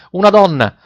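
urgent narrated cry; sailors' shout. A narrator's reading. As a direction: call out for the next passenger. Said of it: "gridarono agli ore-, i marinai".

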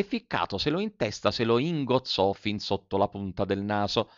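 plain reading voice

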